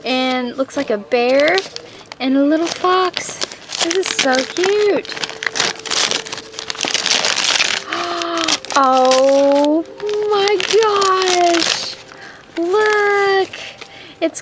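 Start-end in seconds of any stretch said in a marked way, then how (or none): 5.2-8.8 soft breathy gasp of delight. Real, not acted. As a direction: gasp at a cute surprise. Sees the next ornament.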